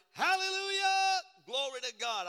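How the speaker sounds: high, loud hallelujah cry; loud worshiper shout. Retold or imitated. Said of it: "I'm gonna be like".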